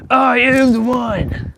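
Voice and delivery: slow, high, loud grand declaration; defiant proclamation to God. Retold or imitated. Shouting to God from the swing, slow grandiose proclamation. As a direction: proclaim it like a chosen one, slowly. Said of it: "how I used to talk".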